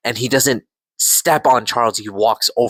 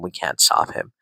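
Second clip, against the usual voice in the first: defeated hush; 8 semitones lower, 6 dB quieter than usual. Retold or imitated. Charles defeated.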